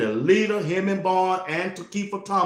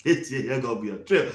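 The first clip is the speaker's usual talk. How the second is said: normal talking voice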